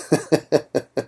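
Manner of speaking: quiet staccato chuckle; soft chuckle. Real, not acted. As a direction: chuckle softly at your own impression. Laughs at own Sulu impression.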